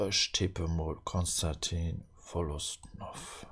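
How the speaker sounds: whisper. Real, not acted. Whispered guess.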